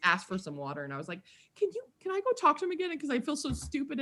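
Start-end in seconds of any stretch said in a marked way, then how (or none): 1.5-4.0 high, fast begging; imitated pleading. Fan begging attendant for another chat, high pleading. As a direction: plead in a rushed, high little voice.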